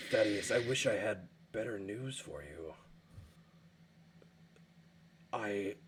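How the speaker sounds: low, soft, fading apology; sorrowful elder. Acted. Glader apologising to Thaddeus, low and soft with regret. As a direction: deliver bad news gently.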